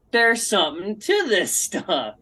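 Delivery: slow, changed-voice realization; drawn out, as if won over; slow, awed admission that the oils work; convinced. Retold or imitated. Convinced by cleaning result.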